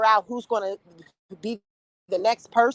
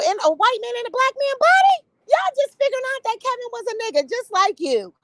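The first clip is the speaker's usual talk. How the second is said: high, sing-song taunting voice; mocking taunt; 11 semitones higher than usual. Vlogger taunting followers in high mock voice. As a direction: tease them in a high mocking voice.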